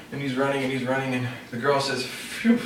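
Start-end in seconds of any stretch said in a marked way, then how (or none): 2.1-2.6 unvoiced whoosh of relief; breathy sigh of relief. Girl sighs with relief as the ogre runs off, breathy phew.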